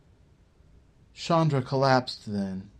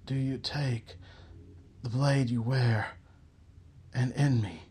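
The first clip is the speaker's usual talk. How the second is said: soft, partly whispered plea; barely voiced, hollow question; murmured; 4 dB quieter than usual. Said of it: "Chandra murmured".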